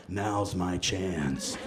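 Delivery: low, slow sly scheming. Retold or imitated. Fairy wife spotting her chance, low sly scheming. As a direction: purr it like a plotting villain. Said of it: "she's like".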